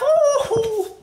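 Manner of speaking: squeaky ooh at the burn; high pained ooh. Real, not acted. Wasabi burning in mouth.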